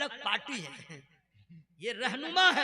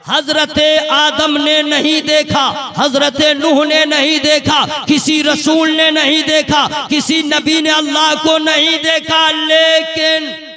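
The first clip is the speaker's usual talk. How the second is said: slow, much louder, ringing sermon voice; preaching at full force; 4 semitones higher, 20 dB louder, slower than usual. Acted. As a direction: thunder each line to the crowd. (पूरे जोश में तक़रीर)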